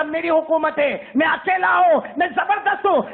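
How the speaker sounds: preacher voicing God's proclamation, shouted; commanding declaration. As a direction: proclaim absolute power. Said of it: "اللہ کہے گا".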